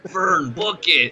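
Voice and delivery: high, drawn-out command; slow, high-pitched urgent mock call; mock eager order. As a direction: imitate a promoter excitedly demanding it. Imitating eager promoter after many calls.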